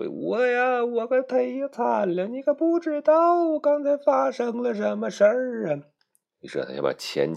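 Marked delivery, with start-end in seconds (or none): 0.1-5.8 very high, whining queen; 拖长音哭诉的王后; queen's lament. Acted. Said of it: "王后就说". (尖细哀怨的王后腔)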